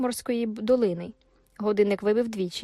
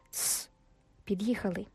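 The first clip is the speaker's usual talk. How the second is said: hushed whisper, nearly breath only; a soft hiss of warning; 8 dB quieter than usual. A narrator's reading. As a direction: whisper so the gatekeeper won't hear. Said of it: "прошепотів Габріель".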